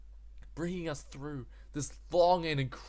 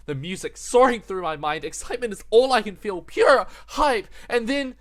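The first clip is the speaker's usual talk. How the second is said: louder and higher, rushing with excitement; building hype; 8 semitones higher, 10 dB louder than usual. Real, not acted. Narrator gushing over the music.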